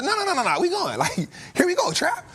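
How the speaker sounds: excited imitation of a follower; eager followers. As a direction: play a fan rushing in anyway. Voicing eager followers ignoring warning.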